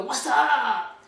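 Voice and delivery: loud, drawn-out what's up; pupil's greeting. Retold or imitated. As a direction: imitate a pupil hollering a casual greeting. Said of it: "Some of you go".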